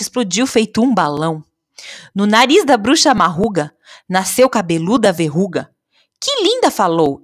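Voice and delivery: animated, emphatic verse recital; poem reading. A narrator's reading. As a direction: perform comic witch rhymes with swinging pitch. Recites witch limericks.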